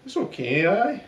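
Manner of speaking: lilting, badly faked Welsh accent; a poor stab at Welsh. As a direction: put on a dodgy sing-song Welsh accent. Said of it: "the worst Welsh accent I've ever heard".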